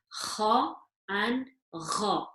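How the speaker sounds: kha scratched, gha gargled, both slow; kha and gha drawn out, scratch against gargle. Shown as a demonstration. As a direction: contrast kha and gha slowly in the throat. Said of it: "slight gargle or a slight scratch".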